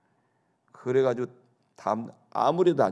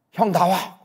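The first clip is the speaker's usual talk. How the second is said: raised, louder retold call; 4 semitones higher, 5 dB louder, slower than usual. Boy at the door summons the older boy, calling out. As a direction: call someone out of the house.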